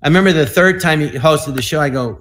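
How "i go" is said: ordinary delivery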